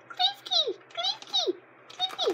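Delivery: very high squeaky name call, last one laughing; Pokemon cry. Retold or imitated. Kid imitating the anime Pokemon cry, very high squeak.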